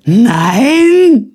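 very high, loud, stretched-out shout; enthusiastic bellow. A narrator's reading. Simpel answering about the little man, gleeful bellow. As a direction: holler it out in glee. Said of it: "brüllte Simpel mit der gleichen Begeisterung".

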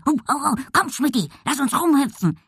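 high, yappy excited dog voice; barking dog. A narrator's reading. Dog urges cat to play, barking high. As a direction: yap eagerly between the words. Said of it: "bellte Bonsai".

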